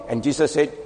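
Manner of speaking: ordinary talk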